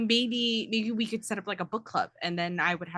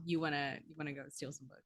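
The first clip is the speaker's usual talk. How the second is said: hushed, lower question; 6 semitones lower, 12 dB quieter, much faster than usual. Acted. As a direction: ask it under your breath. Startled, hushed at plan.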